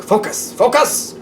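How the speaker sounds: high, cartoonish "Focus!"; squeaky mock command. Shown as a demonstration. Reader cheering viewers on, high playful "Focus!". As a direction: chirp it like a cheerleader. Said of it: "urging viewer playfully".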